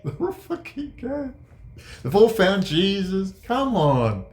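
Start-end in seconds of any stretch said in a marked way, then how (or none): 0.0-1.3 laughing. Real, not acted. Laughing through his own rant.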